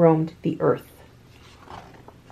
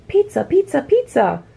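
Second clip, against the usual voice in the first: high alpaca shout; 7 semitones higher than usual. A narrator's reading. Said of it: "shouted the alpacas".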